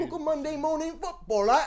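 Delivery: very high, loud mock-English accent. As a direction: squawk it in a hammy English accent. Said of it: "it kinda sounded more English".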